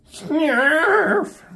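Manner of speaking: very high, loud, long wail of the name; mock anguished cry. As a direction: howl his name in mock grief. Snarf's death, mock wail.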